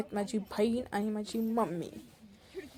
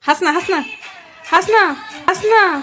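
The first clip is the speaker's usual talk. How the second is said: loud, high coaxing; excited urging; 9 semitones higher, 16 dB louder than usual. Real, not acted. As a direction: egg her on to laugh. Urging someone to laugh. (उत्साहाने, मोठ्याने हसायला सांगणे)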